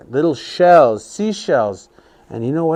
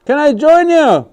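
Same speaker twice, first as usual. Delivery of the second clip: sweet-talking trickster; 9 semitones higher, 5 dB louder than usual. Acted. Sinclair asks to join.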